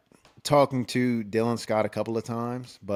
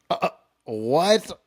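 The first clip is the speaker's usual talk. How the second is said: slow, higher, stammering disbelief; stunned disbelief; 6 semitones higher, slower than usual. Retold or imitated. Told a movie star wants his room, stunned and slow. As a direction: drag it out like you can't believe it. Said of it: "I'm like".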